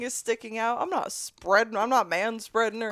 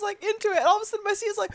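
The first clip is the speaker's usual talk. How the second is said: ordinary talk, nothing marked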